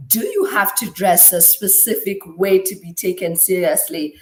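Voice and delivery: normal talking voice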